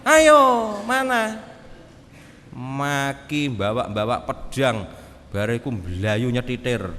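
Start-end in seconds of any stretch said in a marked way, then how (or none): 0.0-1.4 very loud, high "Ayo, mana?"; taunting challenge. Retold or imitated. Imagined Jesus daring Peter to prove his courage, shouted. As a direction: dare him to show it.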